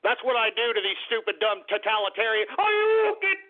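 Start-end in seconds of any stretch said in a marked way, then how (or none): 2.6-3.4 video game shout. Pretend. Host answers the caller with a shouted Hadouken. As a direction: yell it like a Street Fighter move. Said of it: "Can I do a Hadouken?".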